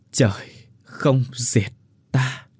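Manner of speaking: very slow, drawn-out last breath; slow dying words. Acted. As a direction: let the line fade out with life. Final words before dying. (chậm rãi hấp hối)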